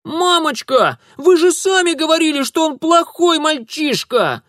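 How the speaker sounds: put-on high, loud little girl pleading; girl's voice. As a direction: protest like a bratty child. Little girl defending herself.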